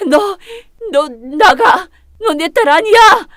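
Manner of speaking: loud, high, tearful yell; a mother screaming through tears; furious shout. Acted. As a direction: throw her out, voice cracking with rage. Mother throws daughter out.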